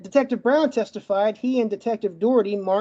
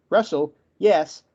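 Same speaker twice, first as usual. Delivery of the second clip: ordinary talk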